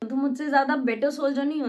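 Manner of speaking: normal talking voice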